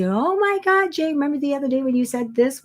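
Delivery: imitating an excited email writer; excited fan quote. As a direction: squeal it like an amazed client. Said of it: "and the emails say".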